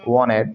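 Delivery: "wanted" said as wah-ned; dropped t. Shown as a demonstration. Teacher demonstrates for students, "wanted" without its t. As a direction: let n swallow the t in "wanted". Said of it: "टी गायब कर दिया".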